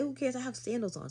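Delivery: high, breezy retort in a quote; imitated carefree dismissal. Date laughing off her mismatched Crocs, high and carefree.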